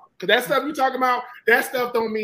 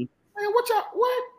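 mock disbelief; 12 semitones higher, much slower than usual. Mock disbelief, pitch leaps.

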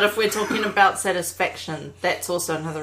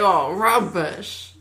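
long drawn-out "Oh, rubbish"; groaning fan heckle; 4 dB louder, much slower than usual. Acted. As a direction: drag it out like a disgusted fan. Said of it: "But I reckon people say".

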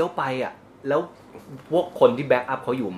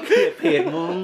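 high giggling jab; teasing laugh; laughing tease; 3 dB louder than usual. Real, not acted. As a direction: laugh it out at him. Teasing friend through laughter.

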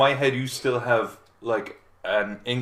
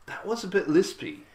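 self-critical; 8 semitones higher than usual. Retold or imitated. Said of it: "you're like".

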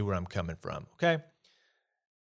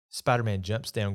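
ordinary delivery

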